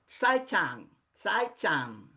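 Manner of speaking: tsai chan repeated, set apart from the Portuguese; Mandarin goodbye. Shown as a demonstration. Teacher models goodbye tsai chan for the class.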